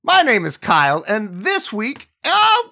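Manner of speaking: big upbeat podcast host intro; hyped host welcome over music; announcer intro. Acted. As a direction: sell the show opening like a radio host.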